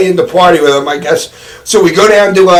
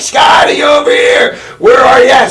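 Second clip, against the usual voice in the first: calling out high across the parking lot; yelling; 7 semitones higher than usual. Retold or imitated. Wife calling out to find her friend, shouting. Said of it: "she had to go out and, like, yell".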